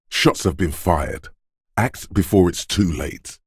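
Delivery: usual speaking voice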